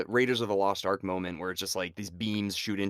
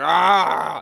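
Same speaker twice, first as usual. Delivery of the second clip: loud high yelp of panic; mock panicked yell; 6 semitones higher, 10 dB louder than usual. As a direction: yelp like a man in a frenzy. Cochran's yell before hanging up.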